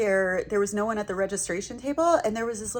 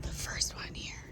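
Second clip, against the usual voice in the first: whispered; 13 dB quieter than usual. Real, not acted. Quiet tour of brag table.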